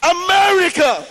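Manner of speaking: man on a skyscraper shouting his ultimatum; shouting threat. Retold or imitated. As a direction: yell the threat down to the city. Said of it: "and he was shouting".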